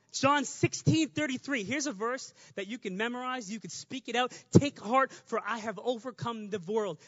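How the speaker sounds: ordinary talk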